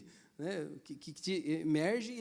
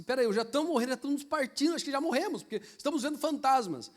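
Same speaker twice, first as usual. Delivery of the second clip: higher, louder mock panic; panicked disciples; 7 semitones higher, 5 dB louder than usual. Disciples seeing a ghost, hammed-up panic. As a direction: act out terrified men at sea. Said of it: "Falou assim".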